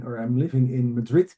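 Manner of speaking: ordinary talk, nothing marked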